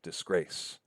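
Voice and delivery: DISGRACE stressed on GRACE; soft dis leading into a weightier GRACE in DISGRACE; iambic stress. Shown as a demonstration. Lecturer matching word to iamb, second syllable stressed. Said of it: "but disgrace".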